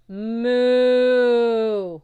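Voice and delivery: long, high, loud cow moo. Acted. The cow serpent calling to Percy, a long loud moo. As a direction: hold out a big drawn-out moo.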